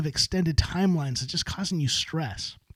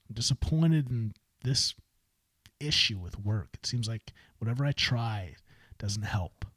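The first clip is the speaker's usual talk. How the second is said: a touch of quiet disappointment; mock dejected; 6 semitones lower, 5 dB quieter than usual. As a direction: lower and softer, trailing off. Voicing a disappointed owner.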